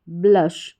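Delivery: ordinary talk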